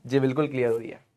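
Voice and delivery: low, hushed, quick answer; meek student reply. Acted. Students answering the teacher that it is clear, hushed. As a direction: voice the class's answer under your breath.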